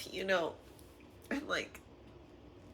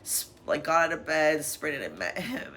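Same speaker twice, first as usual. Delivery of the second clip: ordinary talk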